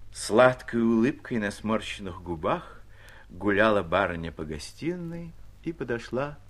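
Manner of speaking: ordinary delivery, nothing marked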